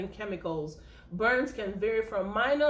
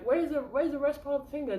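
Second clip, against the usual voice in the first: quick, higher, lightly acted question; puzzled ER nurse; 4 semitones higher, faster than usual. Nurse asking a patient at the ER where the finger is, quick and higher. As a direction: rush out the question as if baffled at the missing finger.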